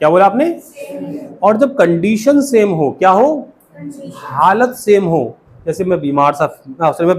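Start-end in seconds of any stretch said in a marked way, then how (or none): 0.6-1.4 breathy whispered repeat of the phrase; soft whispered drill. Shown as a demonstration. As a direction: whisper it to the class. Teacher repeats model phrase.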